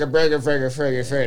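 imitating garbled, incoherent texting; mock drunk babble. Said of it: "Are you drunk?".

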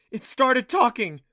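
high, loud, drawn-out cry of disbelief; alarmed shriek. Acted. Clancy shows Adam the broken talking slab, loud alarm. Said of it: "Clancy shrieked".

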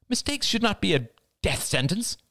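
high-pitched, impassioned protest; crying out. A narrator's reading. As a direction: cry it out without shouting. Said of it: "cried Cassie".

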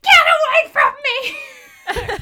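imitating Yzma's yell. Fan quoting Yzma screaming at a squirrel, loud. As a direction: shriek it at the squirrel. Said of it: "she's like".

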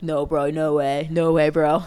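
lightly voiced dude-bro disbelief; frat bro. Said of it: "They're gonna be like".

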